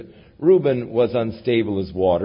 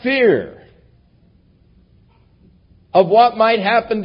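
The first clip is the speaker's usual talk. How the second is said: fervent preaching; 8 semitones higher, 8 dB louder than usual. Acted. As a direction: proclaim it with rising conviction, full voice. Preaching rises to full force.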